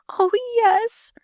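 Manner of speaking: very high, weak moaning. Acted. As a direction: moan it weakly. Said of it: "the weak voice moaned".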